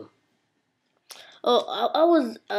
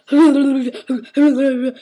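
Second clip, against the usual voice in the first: imitated loud cackle; guy's loud laugh; 3 semitones higher, 8 dB louder than usual. Imitating a guy at the gun range, loud laugh. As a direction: mimic a stranger laughing loudly. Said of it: "we heard some guy going".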